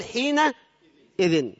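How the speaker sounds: حينئذ stretched very slowly, the tanween ending en held out; drawn-out example word. Shown as a demonstration. Teacher spelling out حينئذ for students, very slow. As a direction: lean on the nunation of حينئذ. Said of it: "بالتنوين عوضا عنها فقال".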